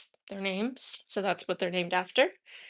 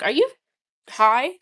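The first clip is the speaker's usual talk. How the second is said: mock-indignant plant, louder; pissed-off plant; 3 semitones higher, 10 dB louder than usual. Plant scolding the owner who repotted it, mock pissed. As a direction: voice an offended plant, half joking. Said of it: "he was pissed".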